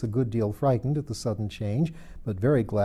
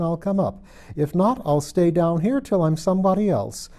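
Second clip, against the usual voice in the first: put-on girlish voice, raised and insistent; a stubborn child declaring herself, higher; fretful Alice, higher and louder; 5 semitones higher, 4 dB louder than usual. A narrator's reading. Alice voiced in read story.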